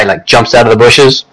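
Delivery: usual speaking voice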